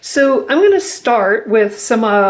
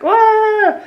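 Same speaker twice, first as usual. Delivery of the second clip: hyper yelp; 12 semitones higher than usual. Pretend. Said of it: "He's like".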